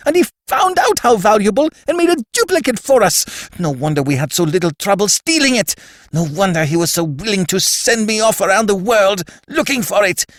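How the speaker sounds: high, loud, spluttering hysterical shriek; shrill spluttering scream. A narrator's reading. Levantine to Gutman, finding the fake, shrill scream. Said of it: "he screamed at Gutman".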